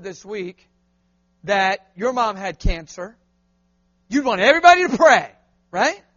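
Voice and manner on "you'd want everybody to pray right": 1 loud urging. Acted. Preacher presses a point.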